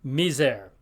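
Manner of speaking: misère with a Z between the vowels. Shown as a demonstration. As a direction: say misère as "mee-zair". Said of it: "a Z sound to it".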